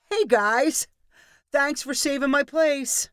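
high, bright-faced cheery greeting; friendliest voice. A narrator's reading. Danny greeting the Steelers fans to reclaim his spot, overly friendly. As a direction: charm them as if nothing happened. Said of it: "in the friendliest possible voice".

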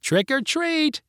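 put-on very high, drawn-out children's chant; mock kids' yell. A narrator's reading. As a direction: pipe it up slowly, without much volume. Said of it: "yelled the four kids".

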